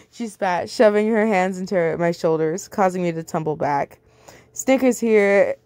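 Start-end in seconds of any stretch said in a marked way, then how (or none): none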